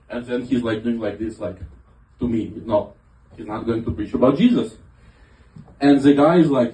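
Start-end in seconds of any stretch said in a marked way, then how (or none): none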